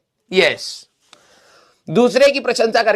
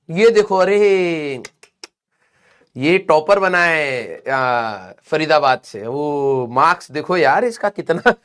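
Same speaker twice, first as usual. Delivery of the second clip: lightly voiced mom, slow and lower, pointedly admiring; mom comparing; 6 semitones lower, slower than usual. Mom with the newspaper needling her son about the topper, slow and pointed. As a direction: drag out the praise of someone else's kid.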